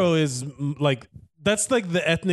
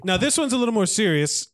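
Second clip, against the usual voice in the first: ordinary talk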